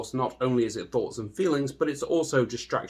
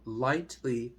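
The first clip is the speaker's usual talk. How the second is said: guided meditation; 7 dB quieter than usual. Acted. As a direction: ease the listener into stillness, hushed and unhurried.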